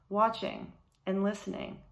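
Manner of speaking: usual speaking voice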